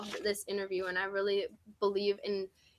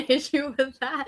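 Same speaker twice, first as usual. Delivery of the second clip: usual speaking voice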